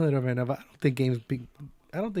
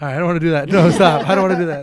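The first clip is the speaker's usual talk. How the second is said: laughing; 4 semitones higher, 8 dB louder than usual. Real, not acted. Laughing refusal of ASMR.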